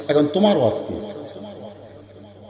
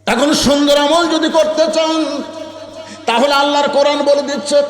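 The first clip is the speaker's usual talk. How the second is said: melodic preaching; 7 semitones higher than usual. Real, not acted. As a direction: intone it to the crowd, stretching the line endings. (সুরে সুরে টানা ওয়াজ)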